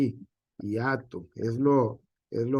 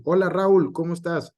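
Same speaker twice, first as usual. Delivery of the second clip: higher greeting, "Raúl" stressed on the a as RÁ-ul; mock hello, running "Raúl" into one syllable; saludo con diptongo; 4 semitones higher, 5 dB louder than usual. Shown as a demonstration. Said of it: "con el acento en la A". (saludo agudo, "Raúl" con acento en la a)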